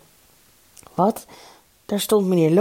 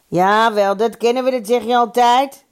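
loud, raised heckle; higher, projected retort; calling out; 5 semitones higher, 4 dB louder than usual. A narrator's reading. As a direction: jeer it across the hall. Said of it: "riep Piet Zuiderstein".

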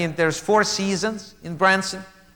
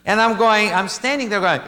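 usual speaking voice

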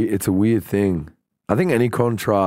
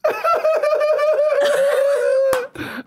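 very high, long squealing laugh; mock hysterical giggle; imitated cackle. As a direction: cackle in falsetto like a gleeful prankster. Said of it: "he answers the FaceTime and he goes".

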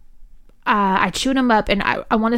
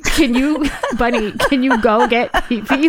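high, louder mock-tearful plea broken by a laugh; imitated crying plea; 5 semitones higher, 4 dB louder than usual. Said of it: "Like, I'm crying".

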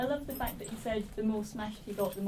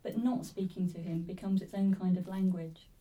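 normal talking voice

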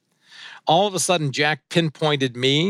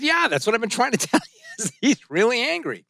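exasperated laugh; 9 semitones higher than usual. Real, not acted.

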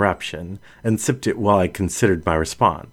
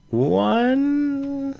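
lingering question. A narrator's reading. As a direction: voice lifted high on "One?", drawn out. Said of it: "lifted her voice in a lingering question".

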